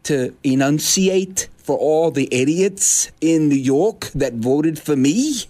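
slow, mocking over-enunciation; slow patronizing enunciation; over-enunciated slow. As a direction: spell it out slowly as if to idiots. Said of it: "he needs to talk like this".

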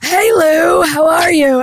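loud hammed-up pirate hello; mock pirate greeting. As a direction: call out like a jolly pirate. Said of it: "He was like".

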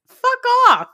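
high, loud burst of disgust; hammed-up indignant shout; mock outraged rebuff. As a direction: yell it at a creepy suitor. Mock rebuff to pick-up line.

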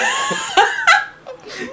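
high, loud shrieking laugh; long uncontrolled cackle; big laughing fit. Real, not acted. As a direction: burst out in helpless high laughter. Breaks up after random remark.

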